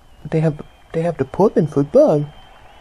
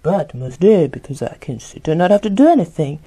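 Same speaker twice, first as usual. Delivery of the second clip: usual speaking voice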